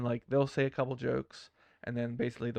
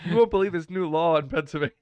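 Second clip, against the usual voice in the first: loud, higher clickbait hype; tabloid tv host; 5 semitones higher, 9 dB louder than usual. Retold or imitated. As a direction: shout it like a tabloid teaser. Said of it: "every time I post a thumbnail".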